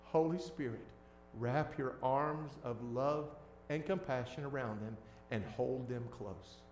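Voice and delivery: soft, hushed prayer. Retold or imitated. Pastor quietly praying over the hurting, soft. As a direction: pray it gently over them. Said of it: "I prayed this prayer verbatim".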